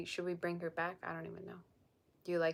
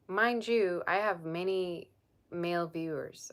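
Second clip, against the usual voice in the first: ordinary talk, nothing marked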